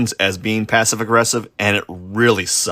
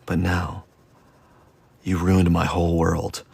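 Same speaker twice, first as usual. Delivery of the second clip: ordinary talk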